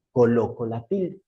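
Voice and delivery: usual speaking voice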